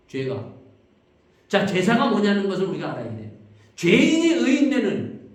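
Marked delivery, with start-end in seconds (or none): 3.8-5.3 higher, louder, slowed-down key phrase; fervent emphasis. Real, not acted. Preacher stressing the core truth to the congregation, loud and slow. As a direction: land the words as the heart of the sermon.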